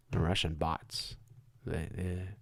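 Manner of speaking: a hushed, sarcastic echo; mock undertone. Real, not acted. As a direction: mutter it back mockingly.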